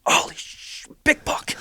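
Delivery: forceful, voiceless hiss of excitement; startled stage whisper. Retold or imitated. Hunter spotting a big buck over dad's head, startled whisper. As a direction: whisper it hard, as if the deer might hear.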